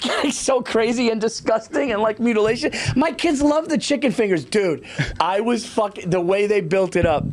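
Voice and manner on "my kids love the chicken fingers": high, chirpy imitation of chit-chat; breezy small talk. Voice actor talking family dinners between takes, breezy. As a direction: toss it off lightly in a raised voice.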